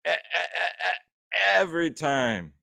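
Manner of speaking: drawn-out stutter into a catchphrase, put-on voice; lightly put-on wrestler catchphrase impression; Chris Jericho. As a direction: stutter the first sound slowly, then land the phrase. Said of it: "That's my Chris Jericho impression".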